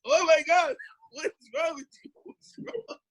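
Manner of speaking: imitating a panicked mother's high cry; startled mom. Mom hearing her son roar on the phone, high shocked cry. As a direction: squeal it out, shocked and alarmed, fading off. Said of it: "She was like".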